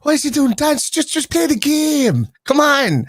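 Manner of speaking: very high, slow exasperated complaint; Roy Keane. Acted. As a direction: impersonate a fed-up Cork pundit. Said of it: "I can do the accent".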